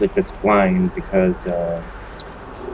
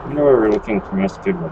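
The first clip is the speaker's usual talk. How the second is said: ordinary talk, nothing marked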